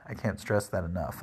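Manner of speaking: usual speaking voice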